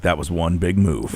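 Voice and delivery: low slow quoted line. Retold or imitated. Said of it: "you're like".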